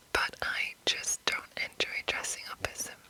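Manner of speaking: ordinary talk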